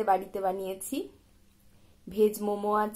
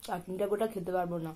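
licking fingers between words; chewing while talking; 3 semitones lower, 7 dB quieter than usual. Real, not acted. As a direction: mouth partly full. Seen 1: Food put in mouth at -2; lips pressed, chewing, finger-licking throughout.

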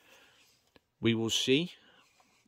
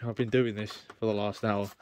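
ordinary delivery

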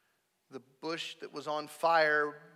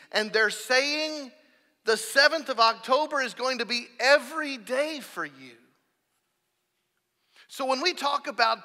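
loud, high impassioned sermon; full-force preaching; 8 semitones higher, 9 dB louder than usual. Acted. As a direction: preach it hard to the congregation.

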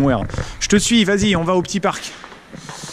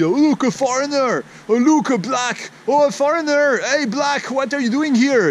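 higher-pitched excited calling out; mock blunt exclamations; 7 semitones higher than usual. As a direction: act out a passer-by spotting a foreigner. Acts out blunt people in China.

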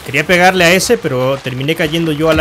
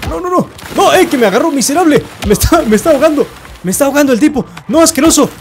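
panicked protest; 7 semitones higher than usual. Real, not acted. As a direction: yelp in outrage while being drowned. Being drowned by opponent in game.

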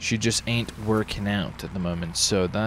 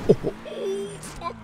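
much higher, panicked oh oh oh fuck; alarmed cry; 4 dB quieter than usual. Real, not acted. Gamer about to crash mid-race, panicked cry. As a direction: panic as it goes wrong.

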